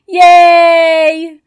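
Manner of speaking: loud, high happy cheer; excited cheer. Acted. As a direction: cheer for the kids. The lesson is finished.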